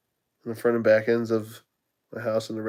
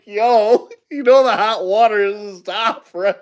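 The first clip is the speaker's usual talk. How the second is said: teasing quote; 8 semitones higher, 3 dB louder than usual. Retold or imitated. Teasing coworker about hot water.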